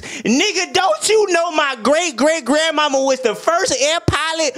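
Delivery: very high, hammed-up outraged woman's voice; mock indignant woman. Girlfriend dodges the dishes question, hammed-up high outrage.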